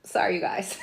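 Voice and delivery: hushed sheepish whisper; whispered apology. Real, not acted. Host apologizing to viewers after cursing, whispered. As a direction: whisper an embarrassed sorry.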